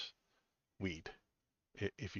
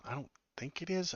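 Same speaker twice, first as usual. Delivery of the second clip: incredulous; 9 semitones higher than usual. Real, not acted. Repeating the doubt, much higher.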